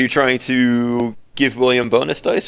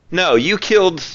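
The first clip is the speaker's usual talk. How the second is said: calling out; 9 semitones higher, 3 dB louder than usual. Acted. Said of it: "you'll hear a different voice call out".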